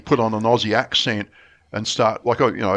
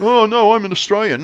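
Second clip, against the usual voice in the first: higher and louder, offended denial; indignant insistence; 10 semitones higher, 5 dB louder than usual. Retold or imitated. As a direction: protest as if wounded. Bloke insists again.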